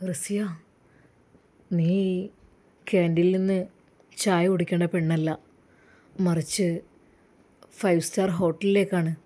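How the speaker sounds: low, smooth pushy suitor; sleazy villain. Acted. Jaseer tries to lure Rasiya from hospital, low and smooth. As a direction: coax her out for tea. Villain promises five-star hotel.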